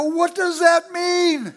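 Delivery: slow, loud, high confused cry; a slow, loud, high-pitched bewildered question; bewildered shout. Retold or imitated. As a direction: shout it out, baffled and overwhelmed. Bewildered boy at altar.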